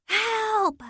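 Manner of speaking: high, strained scream; raw cry for help. Acted. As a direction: force it out through a sore throat. Tied up, calling for rescue.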